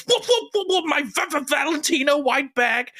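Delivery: very high stammering squawk; hammed-up panic, stuttering up high; imitated shocked mother. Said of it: "and she goes".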